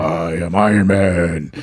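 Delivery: slow, low robotic hero voice; Iron Man voice. Pretend. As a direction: deliver it slow and deep like an armored hero. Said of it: "Can we call you Iron Man?".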